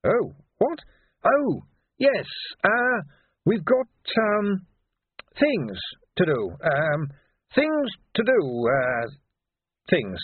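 high, halting, bumbling cover-up; flustered realisation. A narrator's reading. Q finally taking Sweeper's hint, flustered and halting. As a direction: catch on late, stumbling slowly through "things to do".

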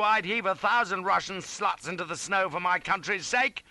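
high, loud, righteous tirade; indignant harangue. A narrator's reading. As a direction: harangue him with patriotic outrage. Shouted patriotic harangue at East.

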